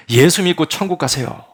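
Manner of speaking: preacher shouting from the pulpit. Acted. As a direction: shout it to the congregation. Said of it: "소리를 지르면".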